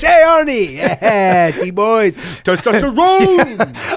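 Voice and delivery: high hammed-up bro voice, drawn-out ending; bro-ing up Arnold; mock macho Cameron.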